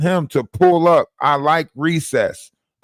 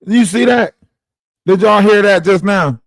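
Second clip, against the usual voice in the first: raised, excited voice; loud excited; 3 semitones higher, 7 dB louder than usual. Real, not acted. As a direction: call it out to the chat. Excited at the recording.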